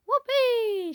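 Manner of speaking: high, loud gleeful shout; shouted whoopee. A narrator's reading. Grandma shouting for joy as she grows. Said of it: "she shouted".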